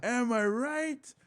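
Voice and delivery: high, drawn-out catchphrase; mock audience call-back; imitated crowd response. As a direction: stretch it out high, like a crowd chiming in.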